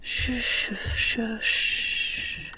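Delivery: put-on gentle whispered shh to soothe a child; soft shushing. Mother soothing her sick daughter, whispered shushing. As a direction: hush her softly, drawn out.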